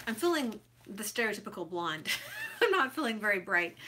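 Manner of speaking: self-mocking joke said through laughter; laughing at herself. Real, not acted. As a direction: laugh at your own joke as you say it. Joking about herself, laughing.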